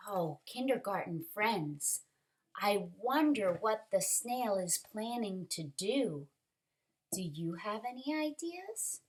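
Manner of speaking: ordinary delivery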